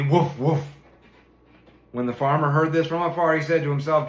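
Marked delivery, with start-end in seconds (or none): 0.0-0.6 louder spoken woofs; loud barking. A narrator's reading. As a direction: bark it out loudly. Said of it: "barked loudly".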